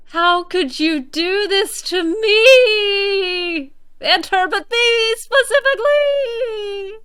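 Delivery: hammed-up crying lament, high and loud; theatrical fake sob of betrayal; mock wailing. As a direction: wail in playful despair at the game. Mock despair over game scene.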